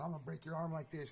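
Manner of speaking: high, loud threat while snapping sticks; furious high threat; angry threat. Retold or imitated. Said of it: "I'm totally just pissed off".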